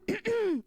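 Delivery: loud, high throat clear; pointed throat-clearing. A narrator's reading. Summer breaking an awkward silence, clearing her throat. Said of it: "Summer cleared her throat".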